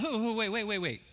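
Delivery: high, laughing plea to wait; laughing call. Retold or imitated. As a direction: call him back through a laugh. Calling back the man walking away.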